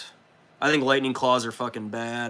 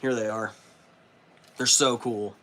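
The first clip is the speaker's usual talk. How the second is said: ordinary delivery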